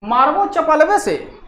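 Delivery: sharp high shout on चुप, then the threat; ऊँची डाँट वाली चीख; angry scolding. Acted. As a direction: scold a naughty child, snapping. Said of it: "नहीं कहता है कि".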